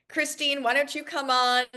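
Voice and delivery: bright high call of the name; calling her on. Real, not acted. Host calling up the trainer.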